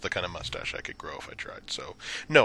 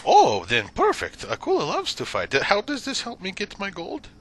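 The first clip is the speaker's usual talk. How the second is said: eager fighter Akula; 8 dB louder than usual. Acted. Fighter hears he gets to fight, eager character voice. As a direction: burst out pleased, then question it.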